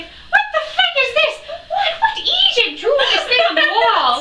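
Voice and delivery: very high, loud mock rant; angry Irish woman. Mum ranting about the wall drawing, mock furious. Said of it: "angry Irish woman".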